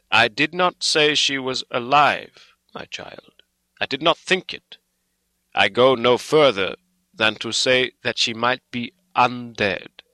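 put-on loud, emphatic, measured old doctor; Van Helsing, grave and insistent. A narrator's reading. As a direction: correct him firmly, weighing each phrase.

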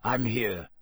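growled, falling character voice; wheezy growl. A narrator's reading. Moody limping up to Dumbledore, wheezy growl. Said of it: "said a wheezy growl".

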